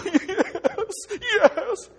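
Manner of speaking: high, loud yes half laughing; tearful yes. Retold or imitated. Man answering his friend, laughing high. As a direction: blurt yes through emotion.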